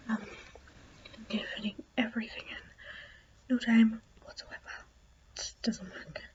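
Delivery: whispering so parents sleep; hushed late-night whisper; quiet at night. Real, not acted. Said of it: "I am very sorry if I'm way too quiet tonight".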